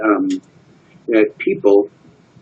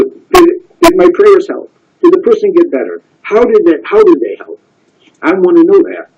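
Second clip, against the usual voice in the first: earnest rabbi asking; 7 semitones higher than usual. Retold or imitated. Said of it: "he says".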